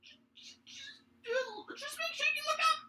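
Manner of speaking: tiny, very high tearful squeak; stammer, then squeal the warning; whimpering kobold warning. Acted. Fallen kobold warns before fleeing, squeaky whimper.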